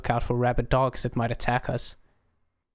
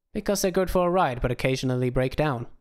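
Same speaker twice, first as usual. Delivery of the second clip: ordinary delivery, nothing marked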